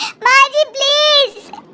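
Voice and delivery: very high, slow, stretched-out "माँ जी please"; drawn-out desperate plea. Acted. Locked-in mother begs mother-in-law to free her daughter, long high wail. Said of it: "जोर जोर से चिल्लाती है".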